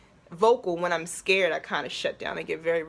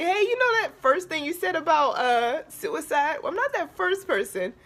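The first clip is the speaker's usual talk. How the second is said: higher and louder, joking; goofy joke; 9 semitones higher, 4 dB louder than usual. Retold or imitated. Woman joking to the speaker about suicide, high playful quip. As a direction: cover the pain with a joke. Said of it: "I'm a goofball".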